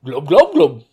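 gurgling fish talk, glub glub; underwater fish speak. Pretend. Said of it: "talking in underwater speak from Atlantis".

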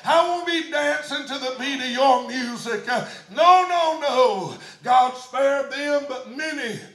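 loud, high preaching at full cry; whooping sermon climax; full-force preaching. Acted. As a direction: preach it to the rafters.

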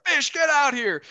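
high, loud barked command; yelling order. Retold or imitated. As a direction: shout them out of their rooms. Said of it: "they start yelling for Fish".

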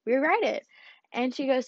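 usual speaking voice

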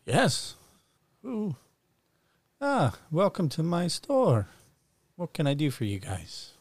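put-on high, slow welcoming old merchant; old shopkeeper voice. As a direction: greet customers like a grand old wizard.

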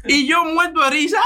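laughing at his own story. Real, not acted. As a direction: talk while cracking up. Laughing while telling the story.

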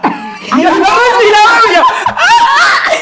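slowed breathless gasp rising to a high yelp; mock gasping. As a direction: act out losing your breath. Retelling hyperventilating.

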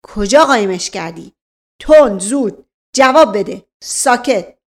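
loud, rapid barked questions; roaring interrogation. Acted. Officer roaring questions at the servant. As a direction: roar at the servant. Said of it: "نایب نعره زد".

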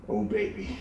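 very soft, muttered reaction; quiet mock dread; hushed dread. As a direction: say it under your breath, retelling a sinking moment. Retold dread at countdown.

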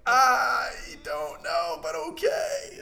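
high squeaky whiny voice; mock whimper. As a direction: whimper it like a reluctant kid. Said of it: "I'm like".

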